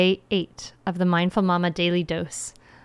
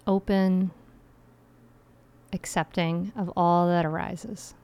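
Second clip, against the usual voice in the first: guided meditation; 4 dB quieter than usual. Acted.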